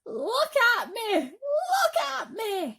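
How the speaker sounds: very high, slow joyful shout; excited shout. Acted. Centipede freed of paint, overjoyed high shout. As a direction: shout it with glee. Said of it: "shouted the centipede excitedly".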